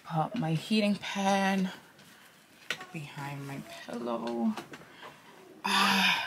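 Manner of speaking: normal talking voice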